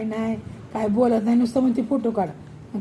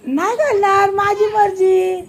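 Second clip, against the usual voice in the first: louder and higher, the second word dragged out slowly; stubborn loud refusal; 6 semitones higher, 4 dB louder than usual. Real, not acted. Refusing her mother to sit cross-legged, loud and stubborn. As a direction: dig in your heels and refuse out loud.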